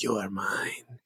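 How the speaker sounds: soft, possessive monster voice; masked creature speaking. Acted. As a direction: claim your victim quietly, as the masked creature. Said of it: "And he says".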